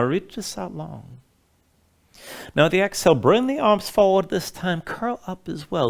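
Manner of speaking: usual speaking voice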